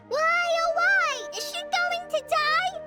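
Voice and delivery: high, drawn-out wailing yell; mock yelling. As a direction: wail it loudly and slowly, imitating a frantic friend. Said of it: "Paimon wouldn't stop yelling".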